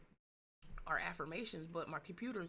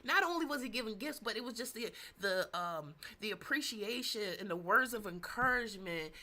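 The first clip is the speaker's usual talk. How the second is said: normal talking voice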